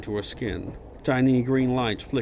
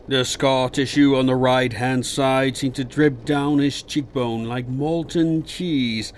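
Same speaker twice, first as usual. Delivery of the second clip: ordinary delivery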